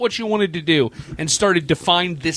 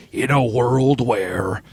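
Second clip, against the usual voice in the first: movie trailer voice; 5 semitones lower, slower than usual. Shown as a demonstration. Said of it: "Speak in your best movie trailer voice".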